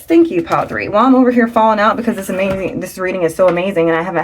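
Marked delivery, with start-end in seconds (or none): none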